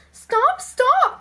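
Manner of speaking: high, loud alarm; loud, high protest; crying out. A narrator's reading. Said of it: "cried Maggie".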